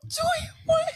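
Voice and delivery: very high-pitched amused disbelief; incredulous. Retold or imitated. Finds sister crying in the kitchen, incredulous. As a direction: squeal it in mock astonishment.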